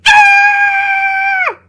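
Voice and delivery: high frightened scream; cartoon scream as the giant bites. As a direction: let out a sharp high yelp of terror. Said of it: "I screamed and I hollered".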